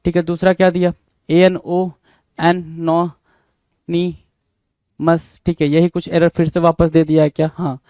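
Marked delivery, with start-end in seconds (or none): 2.4-5.4 "एन नो नी मस" in separate beats with long pauses; syllable by syllable. Shown as a demonstration. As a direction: break "एन नो नी मस" apart, pausing after each piece. Teacher breaks word into syllables.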